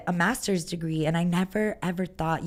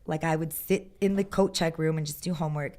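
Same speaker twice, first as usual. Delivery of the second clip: normal talking voice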